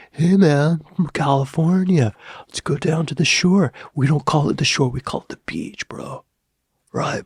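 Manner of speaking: put-on Californian beach bro, words swallowed; Californian accent. Shown as a demonstration. Vlogger playing Californians heading to the beach, swallowed Californian accent. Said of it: "swallow their words like a Californian".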